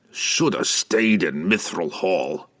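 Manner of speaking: slow grumbling dwarf voice. A narrator's reading. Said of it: "Bruner grumbled".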